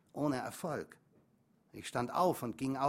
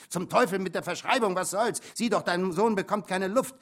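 louder and higher, heated scolding; furious mother; 6 semitones higher, 7 dB louder than usual. Acted. Mother berating the father to give the boy his spray, furious. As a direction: tear into him to make him hand it over.

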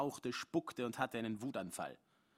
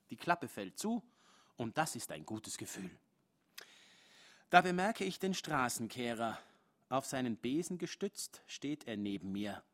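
plain reading voice

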